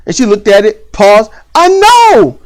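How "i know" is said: high, louder bratty "I know"; snippy daughter retort. Retold or imitated. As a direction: snap back like a teen.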